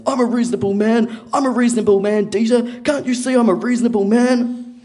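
high, loud mock drunk shouting; drunken yelling. Drunk neighbour yelling in dad's face, loud mock yell. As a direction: yell it indignantly like a belligerent drunk. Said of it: "he's, like, slurring his words, and he's yelling in my dad's face".

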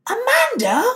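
questioning call, swooping up high; imitated street call with a questioning lilt; calling a name out. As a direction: call after someone, unsure it's them. Woman calling her name in street.

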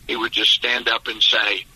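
ordinary talk, nothing marked

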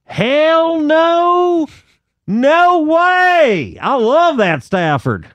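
put-on very high, whooping Dallas accent, words stretched out. Driver denies he expected a Cowboys win, high Dallas accent. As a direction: crow it like an incredulous Dallas fan, in Dallas accent. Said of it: "gonna do a Dallas accent".

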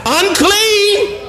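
high, drawn-out cry of unclean. Shown as a demonstration. Preacher modelling the leper's cry to the congregation, high wail. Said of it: "modelled cry".

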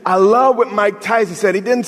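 high, slow, hammering sermon lines; building fervent sermon cadence; full-force preaching. Acted. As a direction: lean into each until like a preacher.

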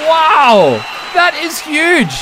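loud, high amazement; big drawn-out astonished reaction; awestruck. Pretend. Host reacting to the school tour news, awestruck.

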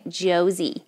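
"Josie" the American way, with a z; American-accented "Josie", z for s. Shown as a demonstration. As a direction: say "Josie" with a buzzing z, falling. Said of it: "I have to say it in their accent, 'cause they put a Z".

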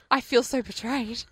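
usual speaking voice

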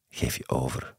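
soft, low "Geef je over"; softly. A narrator's reading. Lover coaxing him to surrender, soft and low. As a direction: hush it, coaxing him to let go. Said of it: "zei ze zacht".

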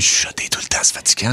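whispered demo of nonstop whispering. Shown as a demonstration. Host shows co-hosts how tiring it is, whispering. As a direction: whisper it, voiceless and hushed.

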